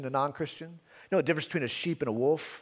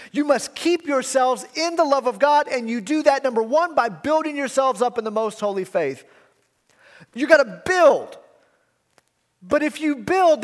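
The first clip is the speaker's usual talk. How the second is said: higher and louder, hammering the contrast; emphatic preaching; 8 semitones higher, 5 dB louder than usual. Acted. Preacher stressing the apostle's contrast to scoffers, emphatic. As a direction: drive home the "but you". Fervent exhortation.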